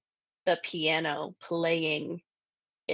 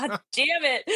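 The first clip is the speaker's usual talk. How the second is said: higher mock-angry curse; imitated mad friend; 8 semitones higher than usual. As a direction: curse like an exasperated friend, retold. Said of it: "he was so mad at me".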